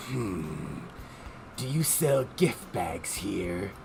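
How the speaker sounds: very low, soft, slow brooding voice; deep, deliberate and aloof; Kirk Thornton. Acted. Brooding passenger asking staff for gift bags, low. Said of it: "do my Kirk Thornton".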